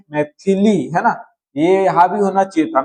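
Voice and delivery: usual speaking voice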